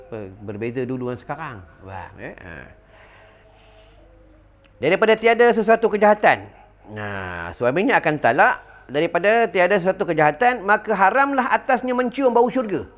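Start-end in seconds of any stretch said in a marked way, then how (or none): 4.8-12.8 higher, louder formal reading of a hadith; preacher reciting a hadith to the congregation; hadith recitation. Acted. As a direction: proclaim the text solemnly. Reads hadith translation.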